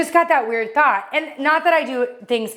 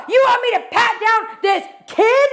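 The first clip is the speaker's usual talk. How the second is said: outraged shout; 10 semitones higher than usual. Retold or imitated. Airport agent shouting at the mom in front of everyone, incredulous yell. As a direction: shout it in disbelief, voicing the agent.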